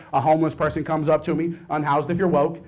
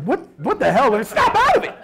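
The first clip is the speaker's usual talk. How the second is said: high startled snap; 8 semitones higher than usual. Retold or imitated. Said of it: "I'm like".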